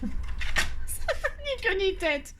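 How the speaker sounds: stretched out very slowly through a laugh. Real, not acted. To her viewers, laughing at her own nonsense. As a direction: dissolve into laughter as you say it.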